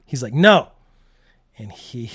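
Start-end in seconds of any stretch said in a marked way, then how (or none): 0.3-0.7 loud, higher mock refusal; blunt refusal. Drunk husband answering his wife's plea, blunt loud refusal. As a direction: cut her off with a loud no.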